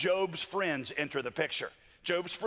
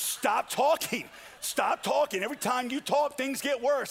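much higher, acted outburst; exasperated plea; 8 semitones higher, 4 dB louder than usual. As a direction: beg them to shut up, pitch soaring. Acting Job fed up with friends.

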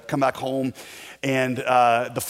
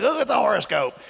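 high-pitched mock old-wife call; loud older wife; 8 semitones higher than usual. Wife showing her husband the newspaper, too loud and high. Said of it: "talk loud enough for people within a three hundred meter radius".

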